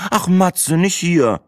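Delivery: high woman's voice, pleading groan. A narrator's reading. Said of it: "stöhnte Breitling und Sina". (stöhnend, mit hoher Frauenstimme)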